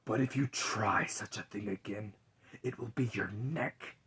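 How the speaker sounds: low, hushed whisper of Death. Acted. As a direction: breathe the words like Death in the dark. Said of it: "he said".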